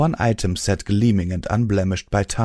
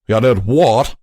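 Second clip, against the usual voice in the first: sharp, louder snap of disbelief; a hint of an angry stallion's snap; snapped; 3 semitones higher, 7 dB louder than usual. A narrator's reading. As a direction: bite the question off. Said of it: "Calamity snapped".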